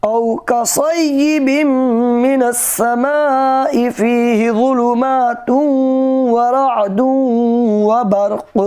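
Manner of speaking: melodic tajweed chant; Quran recitation. Acted. Recites verse again.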